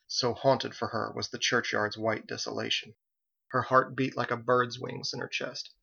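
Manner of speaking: ordinary delivery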